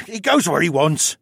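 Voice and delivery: high, loud, exasperated huff; huffy. A narrator's reading. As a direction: huff it out like an annoyed captive. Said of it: "Luca huffed".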